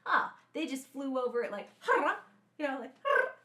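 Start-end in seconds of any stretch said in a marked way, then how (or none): none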